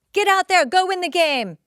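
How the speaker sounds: high, loud coach cheer; pep-talk coach. Retold or imitated. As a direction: fire up the team like a coach. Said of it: "Like a frickin' coach".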